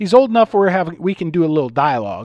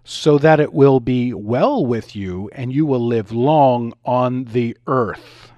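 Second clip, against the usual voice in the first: slow, weighty, lower delivery stressing every word; with emphasis; 4 semitones lower, 4 dB louder, slower than usual. Retold or imitated. Father solemnly impressing Bible verse on crying toddler, slow and emphatic. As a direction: drag it out, each word landing heavy. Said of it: "And then I said with emphasis".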